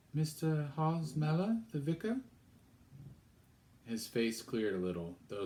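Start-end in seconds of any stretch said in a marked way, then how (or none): 0.1-2.2 put-on high, timid girl's question; young girl's voice. Girl asks stern stranger at the door, high and polite.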